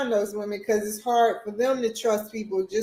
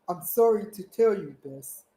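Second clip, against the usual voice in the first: a light imitation of an African doctor's accent, lower; 3 semitones lower than usual. Imitating the African doctor breaking the news, light African accent. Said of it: "I'm gonna try to say it in his voice".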